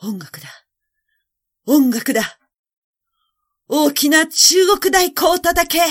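desperate order building to a high, loud shout; loud command. A narrator's reading. Emperor calling for drums to drown the faces, shouting. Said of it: "大きな声で言いました".

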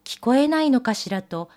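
ordinary delivery, nothing marked

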